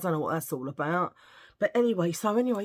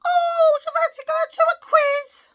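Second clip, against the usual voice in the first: much higher, louder sing-song mum voice; imitating mother; 10 dB louder than usual. Retelling her mother inviting her to the quiz, in a high mock voice. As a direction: chirp it like an eager mother.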